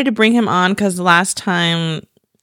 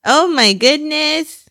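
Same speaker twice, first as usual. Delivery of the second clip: cooing; 9 semitones higher, much slower than usual. Real, not acted. Gushing over the baby.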